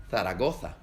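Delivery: Zaragoza with both z's as a lisped th, Castilian way; Spain Spanish Zaragoza. Shown as a demonstration. Teacher demonstrates the Castilian th in Zaragoza. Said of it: "en España suena como Z".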